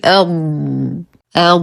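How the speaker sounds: أض held on a lengthened, voiced ض; prolonged dad. Shown as a demonstration. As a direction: stop on the ض in أض and stretch it, no bounce. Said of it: "نقف على الضاد بالاستطالة".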